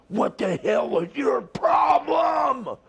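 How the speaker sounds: high, slow shout of outrage; drawn-out mock yell; angry yell. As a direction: bellow it like a furious wrestler. Angry wrestler yelling in ring.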